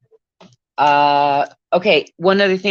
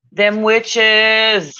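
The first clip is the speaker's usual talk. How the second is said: witches called out higher and louder; louder mock proclamation; 6 semitones higher, 5 dB louder than usual. Shown as a demonstration. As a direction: announce it like a spooky warning.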